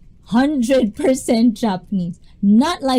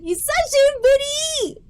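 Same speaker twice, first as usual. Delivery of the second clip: excited squeal. Real, not acted. Greeting long-missed friend.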